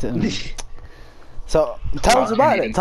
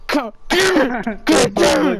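mock crying frustration; 8 semitones higher, slower than usual. Friend imitating Michael punching a pillow, mock tearful rage. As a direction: play crying into a pillow, hammed up. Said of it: "You just hear Michael hitting a pillow".

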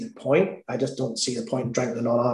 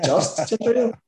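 higher, questioning echo of the order; incredulous waitress; 8 semitones higher than usual. Retold or imitated. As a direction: imitate a waitress querying the order in disbelief. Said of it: "And that's what she said".

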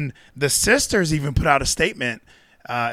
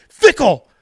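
building comic repeat; 11 semitones higher, 7 dB louder, slower than usual. Real, not acted. Repeating word, rising louder.

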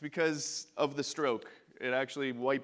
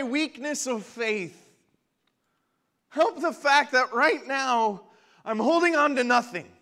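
father's exclamation; 8 semitones higher, 10 dB louder than usual. Acted. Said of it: "the boy's father exclaimed".

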